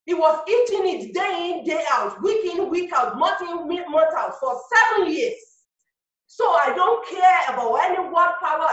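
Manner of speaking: rising, fired-up preaching; rally speech. Acted. Preacher building to a climax.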